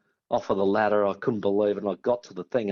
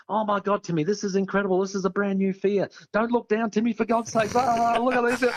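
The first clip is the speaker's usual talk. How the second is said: higher, babbling excited mate; chattering excited; 8 semitones higher than usual. Retold or imitated. Choco babbling to Timmy on the climb, excited and higher. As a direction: gush nonstop like you can't shut up. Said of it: "he will not shut up talking".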